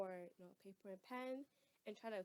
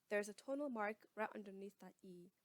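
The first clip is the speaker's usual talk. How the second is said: ordinary talk, nothing marked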